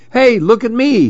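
calling out for attention. A narrator's reading. Chameleon begs the other animals to notice, calling high. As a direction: wave them over with your voice.

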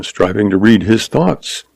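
plain reading voice

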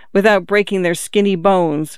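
usual speaking voice

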